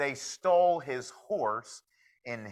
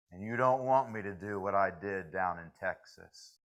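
menacing cowboy; 4 semitones lower than usual. Acted. Cowboy threatens the prank-pulling locals.

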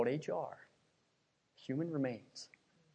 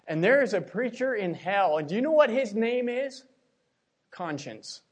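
fiery preaching; 4 semitones higher, 6 dB louder than usual. Acted. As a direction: thunder it from the pulpit. Preaching at full force.

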